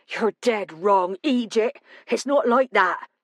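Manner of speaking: put-on irate local in a thick West Country accent; West Country growl. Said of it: "the other man growled, his accent pure West Country".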